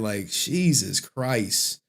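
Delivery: drawn-out exasperated Jesus Christ; slow exasperated sigh. Real, not acted. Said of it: "Why y'all got me talking like this".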